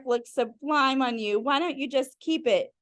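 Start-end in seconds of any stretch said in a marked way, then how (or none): none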